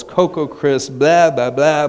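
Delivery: normal talking voice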